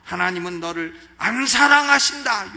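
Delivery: loud, booming devil taunting the believer; a hammed-up loud devil, not a whisper at all. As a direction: play the devil jeering loudly at a doubter. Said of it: "요렇게 마귀가 속닥거리는 거예요".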